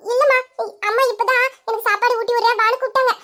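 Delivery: put-on high-pitched kid's chatter; little child. Child telling mother about being called to eat, childlike. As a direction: explain it like a small child.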